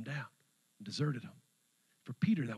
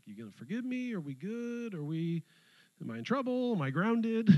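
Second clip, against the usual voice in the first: nervous Peter; 8 semitones higher than usual. Retold or imitated.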